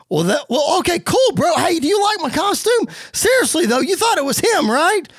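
high, eager bro voice; Matt Riddle. Acted. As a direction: bounce back and fish for approval. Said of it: "Riddle looks dejected".

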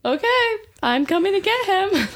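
high, thrilled burst of relief; excited. Retold or imitated. Wife told her husband can come home, thrilled high squeal. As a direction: squeal it like the best news ever.

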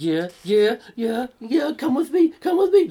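hammed-up eager pleading building up; mock eager plea. Man begging someone to come along, rising eager pleading.

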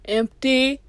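hollow, echoing "empty"; echoey hollow voice. Pretend. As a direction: make it sound like an empty room. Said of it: "so it sounds kinda like".

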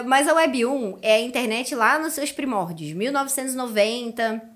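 normal talking voice

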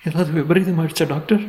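high, worried mother's voice; anxious question. A narrator's reading. Mother asking doctor about harm to baby, anxious high question. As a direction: ask fearfully, pitch held high.

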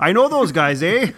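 laughing mock Canadian "I know those guys, eh?"; a light Canadian twang with a laugh; Canadian accent. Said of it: "they're Canadian".

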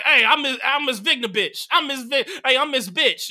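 high, loud mock teacher voice, a light send-up; mocking the vice principal. Kids mimic the vice principal, loud and high, hammed up. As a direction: kids hamming up their tall vice principal. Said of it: "and then we would imitate her".

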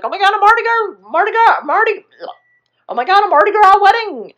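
very high, fast, loud gushing; hammed-up thrilled bride-to-be, squealing; mock squeal of excitement. Charlotte hearing she's getting married, mock excited squeal.

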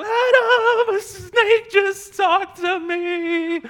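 high, loud, laughing cry of alarm; a breathless wife bursting in on her husband with the news; shocked shout to Adam. Retold or imitated. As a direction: yell it out half laughing, half panicked, drawn out slow. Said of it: "Eve does not run to Adam and go".

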